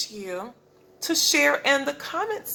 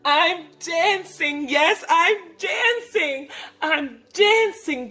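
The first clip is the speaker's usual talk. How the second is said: higher, delighted exclamations; bursting with happiness; joyful cry; 6 semitones higher than usual. Acted. Gerald crying out with joy, higher and delighted. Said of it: "Gerald cried".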